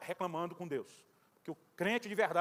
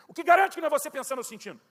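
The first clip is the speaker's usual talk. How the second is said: challenging question; 7 semitones higher, 9 dB louder than usual. Retold or imitated. Challenging the friend's explanation.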